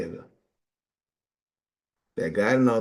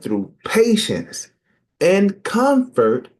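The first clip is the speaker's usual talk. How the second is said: slow, comfort hit much higher and louder; rising emphasis; 10 semitones higher, 5 dB louder, much slower than usual. Shown as a demonstration. Preacher stressing comfort to the listeners, slow. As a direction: build to comfort and land it.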